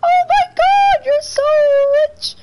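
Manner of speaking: high, loud squealing fan voice; mocking begging player. Trolled players gushing at the streamer, high squeal. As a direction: squeal it like a starstruck beggar. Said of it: "and they're like".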